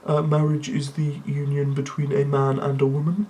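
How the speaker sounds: monotone deadpan voice; imitating an emotionless friend, every word level; Windsor. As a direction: drone it out on one note, emotionless. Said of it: "will go much like this".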